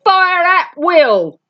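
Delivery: high, loud, slow barked order; ringing high parade-ground shout; shrill command. A narrator's reading. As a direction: sergeant major yelling to open fire. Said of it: "sergeant major's voice rang shrill and clear".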